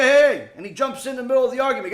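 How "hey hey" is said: loud hey hey to break it up; a bystander butting in with a loud hey; calling out. Retold or imitated. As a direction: jump in and call out to stop them.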